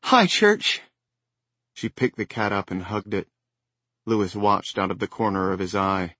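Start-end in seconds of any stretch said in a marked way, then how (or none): 0.0-0.8 high, loud happy greeting; excited cry. Acted. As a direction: greet the cat with delight. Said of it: "Ellie cried".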